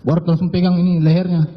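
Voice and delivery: ordinary talk, nothing marked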